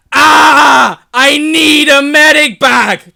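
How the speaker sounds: ear-splitting, high, drawn-out scream; game-line yell. Acted. Troy needs a medic bag, screamed in pain. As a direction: yell it at full volume, stretched out. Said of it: "I can never do this line properly".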